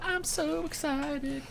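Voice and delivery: slow, stretched-out excited squeal; drawn-out excited. Real, not acted. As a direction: draw out the excitement.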